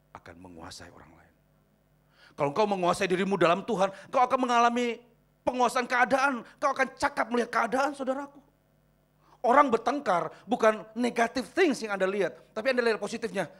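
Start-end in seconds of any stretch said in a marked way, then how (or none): 2.3-13.5 loud, high-pitched exhortation to the congregation; full-force preaching. Real, not acted. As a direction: preach it loud, stretching the key words.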